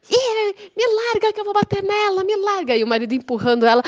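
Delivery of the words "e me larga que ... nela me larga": much higher threatening shriek; mock raging neighbor. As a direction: fight free of the husband, shrill. Neighbor struggling with husband.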